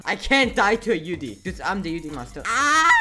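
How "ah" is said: high, loud yelp; sudden scream mid-fight; loud gamer shriek; startled scream. Real, not acted.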